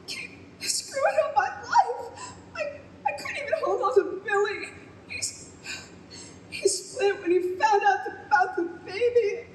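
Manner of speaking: laughing through tears, falling apart; drunken sobbing. Acted. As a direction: sob out the self-blame between broken laughs. Drunk daughter breaks down over parents.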